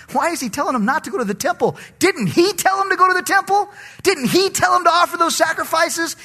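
high, fast, incredulous questions; mock puzzled churchgoer. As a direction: play a confused Bible student objecting. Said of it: "Now you say".

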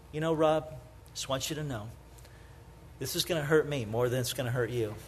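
a quiet, gentle father's voice. Retold or imitated. Father quietly telling his son before a spanking, gentle and soft. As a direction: ease it down like a dad consoling. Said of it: "he would always say".

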